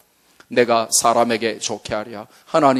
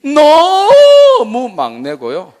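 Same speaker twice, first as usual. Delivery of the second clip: drawn-out, much higher and louder with a laugh; exaggerated; 11 semitones higher, 8 dB louder, much slower than usual. Shown as a demonstration. Preacher joking how youngest he is, exaggerated drawl. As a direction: stretch it out, laughing at the extremes. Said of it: "표준 말로".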